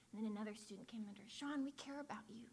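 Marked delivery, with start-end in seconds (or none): 1.3-2.4 soft, unvoiced tender whisper; whispered comfort. A student whispering to Sean under the table. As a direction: breathe it to calm him.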